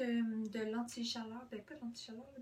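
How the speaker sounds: ordinary talk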